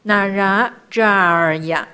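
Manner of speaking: 扎尔 drawn out into an r-coloured tail; 那拉扎尔呀 recited with 扎尔 lengthened; long vowel. Shown as a demonstration. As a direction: hold the long vowel in 扎尔 before 呀. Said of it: "它这里有长音的时候呢".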